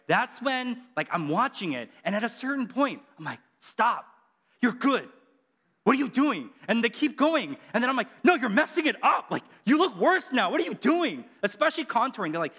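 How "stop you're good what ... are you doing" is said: higher and louder, half-shouting; mock exasperated yell. Preacher yelling at a makeup tutorial in mock outrage. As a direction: scold the screen in mock outrage.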